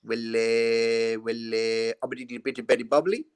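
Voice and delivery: low, drawn-out muddled manager mumble; Ossie Ardiles. Retold or imitated. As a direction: imitate a manager nobody can understand. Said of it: "when I played under Ossie Ardiles".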